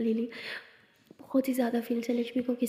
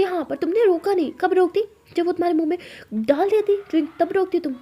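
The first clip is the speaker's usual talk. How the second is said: raised, higher and louder, heated; angry retort; 6 semitones higher, 5 dB louder than usual. Acted. Lakshmi scolding Lily for not stopping the drink, heated raised voice. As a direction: snap back that she never stopped it.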